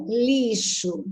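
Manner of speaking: "lixo" said very slowly, sound by sound; slow pronunciation demo. Shown as a demonstration. Teacher asking students to notice "lixo", slow demonstration. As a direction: draw out "lixo" with a clear sh sound. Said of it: "se eu pronunciar lixo".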